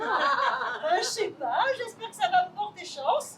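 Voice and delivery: giggling echo of her own sentence; laughing repeat. Acted. Lily repeats every phrase.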